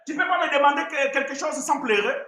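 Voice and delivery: high, exasperated scolding; scolding parent. Retold or imitated. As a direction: tell off a crying child. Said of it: "toi tu lui dis".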